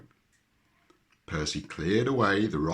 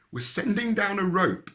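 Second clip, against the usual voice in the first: calling down; 5 dB louder than usual. A narrator's reading. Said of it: "called Percy".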